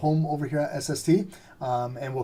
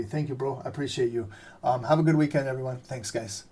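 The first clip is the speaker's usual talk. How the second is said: ordinary talk, nothing marked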